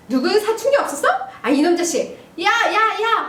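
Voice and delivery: high, scolding outburst; acted angry dad. Dad dismisses puberty excuse and calls son, acted angry scolding. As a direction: bluster as a father banging on a door. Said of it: "그러니까 아빠".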